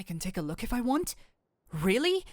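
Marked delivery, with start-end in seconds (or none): 1.7-2.2 high, loud delighted Really; an overexcited, higher Really; eager surprise. Acted. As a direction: jump at the offer. Offered to look at books.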